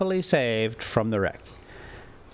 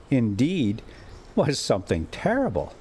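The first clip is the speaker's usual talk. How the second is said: ordinary delivery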